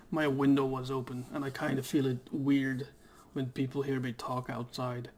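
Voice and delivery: usual speaking voice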